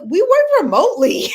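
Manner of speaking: high, loud gleeful quote; retelling the plan with her sister, gleefully high; excited retold quote. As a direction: blurt it out with a laugh.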